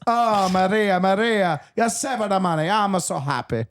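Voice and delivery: put-on high, overjoyed comic Italian accent; mock Italian farmer, delighted; happy Italian farmer. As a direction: gush with relief like an Italian papa. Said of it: "oh, he's Italian".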